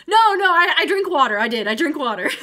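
startled panicked shouting; scared horror game streamer. Real, not acted. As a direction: yelp it out in sudden fright.

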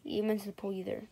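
hushed whisper. Acted. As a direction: whisper it low. Hushed accusation.

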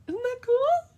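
squeaky high puppet voice, loud; Muppet voice. Pretend. To her viewers, excited about the drying paint, in a Muppet voice. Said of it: "I'm doing my Muppet voice".

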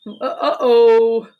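slow hammed-up uh-oh. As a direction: drag out the oops. Drawn-out refrain.

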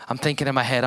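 usual speaking voice

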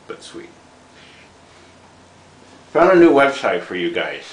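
talking around a cigar; cigar in mouth. Real, not acted. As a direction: lips gripping a cigar. Seen 0: Cigar clenched between lips throughout the labelled words; none in R frames.

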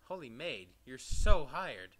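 amazed, bursting loud on the hiring; overjoyed boss, slow and booming at the end; thrilled outburst. Acted. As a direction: build to a big, drawn-out exclamation. Boss thrilled, hires on the spot.

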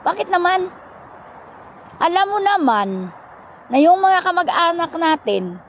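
high-pitched back-and-forth of a quarrel; retold protest. Siblings argue about relatives in the mountains, retold protest. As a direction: act out an exasperated argument.

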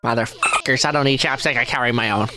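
very low deadpan grumble; sullen refusal. Acted. Squidward refusing the offered chapstick, low and sullen. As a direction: drop low and refuse dourly.

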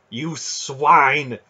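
loud, outraged cry; helpless rage. A narrator's reading. Aunt raging helplessly at the man, furious. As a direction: spit the insult out in helpless fury. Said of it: "Aunt Paul raged helplessly".

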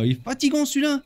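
high-pitched playful whine; put-on exasperated aside; mock complaint. Complains about the repeating vendor.

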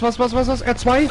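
ordinary delivery

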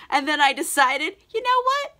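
high-pitched mock self-congratulation; proud of himself. Dorian boasting to Henry of sparing a girl, mock proud. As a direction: boast in a climbing, high put-on voice. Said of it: "He's real proud of himself".

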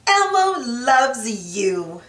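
ordinary delivery, nothing marked

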